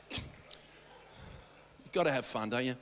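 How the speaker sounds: ordinary talk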